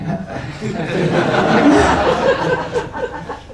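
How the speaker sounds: a warm, real laugh mid-story. Real, not acted. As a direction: burst out laughing at the memory. Laughing while retelling the tenant.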